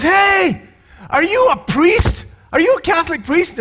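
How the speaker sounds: high, startled call to a stranger; a hint of a surprised guy; surprised driver. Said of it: "And he goes".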